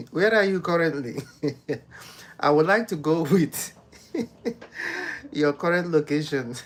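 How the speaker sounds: loud, giggling question; asking between bursts of laughter; laughing. Real, not acted. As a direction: keep laughing as you ask. Laughing while asking a viewer.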